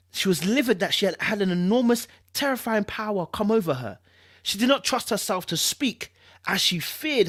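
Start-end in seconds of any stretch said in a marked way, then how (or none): none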